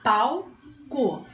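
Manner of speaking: "Palco" broken into pal, pause, co; syllable by syllable. Shown as a demonstration. Teacher counting syllables with pupils, "Palco" in separated beats. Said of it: "Quantas vezes eu abri a boquinha?".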